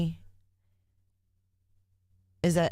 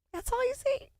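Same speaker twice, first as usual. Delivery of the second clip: very high squeaky repeat, softer; squeaky echo; 4 dB quieter than usual. Pretend. Streamer repeating her own line in a very high squeak.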